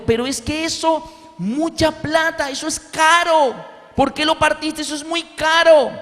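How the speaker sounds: high-pitched mock scolding, slow on mucha plata; hammed-up mother scolding her son. Mother scolds the son for breaking the expensive hearing aid, mock scolding. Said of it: "mi mamá lo regañó".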